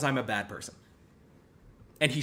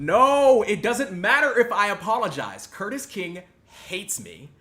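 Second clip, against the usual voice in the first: louder, higher mock-exasperated protest; sarcastic outburst; 6 semitones higher, 6 dB louder than usual. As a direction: overplay the self-blame. Sarcastic self-blame outburst.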